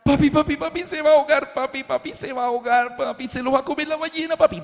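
put-on high, frightened little girl; four-year-old daughter panicking at the movie; scared child crying out. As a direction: plead with papi that the boy will drown. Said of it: "comenzó a gritar".

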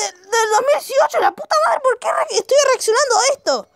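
near-crying indignant shouting; high-pitched exasperated rant. Real, not acted. Ranting about last year's memes.

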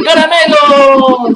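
drawn-out mock child's yell, slow; child shouting. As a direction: stretch it out like a kid begging at the door. Kid at door shouting. (niño gritando "¡Caramelo!" a la puerta, arrastrado)

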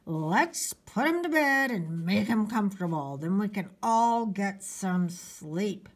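tight, strained mother at her limit; through clenched teeth. Acted. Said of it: "said Missus Monroe through clenched teeth".

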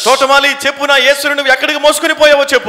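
high, insistent demand for an answer; Mary's plea to gardener. Retold or imitated. Mary questions the gardener about Jesus, high insistent plea.

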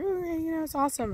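fake enthusiasm. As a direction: pretend to be keen, a bit hushed. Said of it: "I was like".

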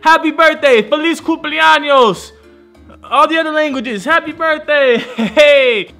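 high loud tipsy cheering. Real, not acted. Drunk birthday yelling.

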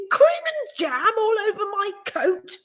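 high, sour complaint about the mess; a bitter, high taxi driver. A narrator's reading. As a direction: grumble it out, resentful. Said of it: "he said bitterly".

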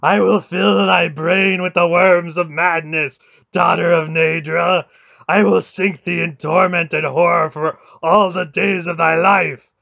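high, bellowed curse; wrathful god screaming damnation; shrieking rage. A narrator's reading. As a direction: pour out threats at full volume. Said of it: "he shrieked at her".

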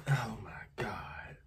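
whispering in pain. Real, not acted. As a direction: breathe it out, hurting. Mouth burning.

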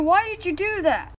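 ordinary delivery

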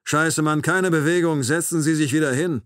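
loud, high, angry order; agitated command. A narrator's reading. Knifeman orders the rising narrator to sit, loud and agitated. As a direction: escalate into a loud, swearing command.

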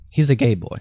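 usual speaking voice

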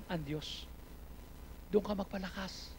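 normal talking voice

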